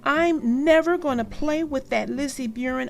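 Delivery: shouted. Acted. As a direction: storm off in a huff out loud. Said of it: "shouted Sister".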